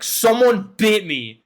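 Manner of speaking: lightly voiced bitten man, slow; mock Keith. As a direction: drag it out like he's hurt. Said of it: "he's like".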